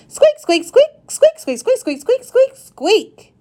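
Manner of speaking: high, bouncy squeaks of an eager hamster; very excited squeaking. A narrator's reading. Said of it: "he says in a very excited voice".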